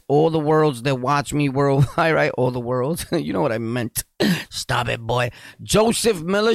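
usual speaking voice

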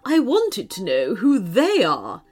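imperious queen's correction; stern queen character; scolding. A narrator's reading. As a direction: correct a subject with royal impatience. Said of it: "scolded the Queen".

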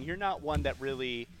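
ordinary delivery

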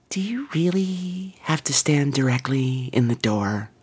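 slow whispered passive-aggressive complaint; little whiny voice. Retold or imitated. Woman on the subway complaining, whispered and slow. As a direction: whisper it, small and put-upon. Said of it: "this little voice behind me goes".

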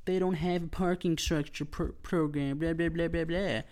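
high, snooty mimic of the complainers; mocking complainers. Mocking the uptight locals who want the event cancelled, high snooty voice. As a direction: sneer it like a prissy neighbor. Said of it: "these people on the little high horses were like".

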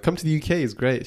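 eager mock persuasion; enthusiastic urging. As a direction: talk someone into it, voice lifted. Retells urging sister to come.

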